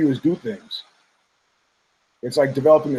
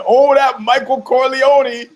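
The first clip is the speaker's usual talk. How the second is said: much higher, louder, slow fond exclamation; mock sitcom gush; 8 semitones higher, 5 dB louder, slower than usual. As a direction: gush over him like a sitcom crowd. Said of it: "Nobody was going".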